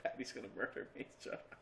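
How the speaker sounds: hushed whisper to the dog; whispered plea. Retold or imitated. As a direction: whisper it so the wife won't wake. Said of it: "It's like".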